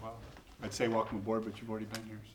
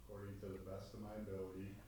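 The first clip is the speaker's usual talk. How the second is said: quiet prompting; 3 semitones lower, 14 dB quieter, faster than usual. Real, not acted. Feeding oath lines to swear-in.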